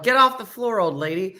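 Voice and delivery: a mild mock yell that fades out. Dina yelling at the fallen old lady, in a mild mock yell. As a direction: bark it at her, then let it drop. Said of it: "Dina yelled".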